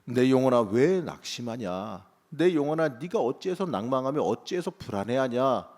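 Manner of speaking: loud, exhorting call to one's own soul; crying out. Acted. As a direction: call out forcefully, stretching the words. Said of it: "다윗처럼 외쳐야죠".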